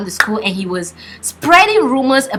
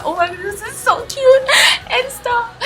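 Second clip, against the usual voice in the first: high squeal over something cute. Retold or imitated. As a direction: squeal like a fan seeing cuteness. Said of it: "I'm like".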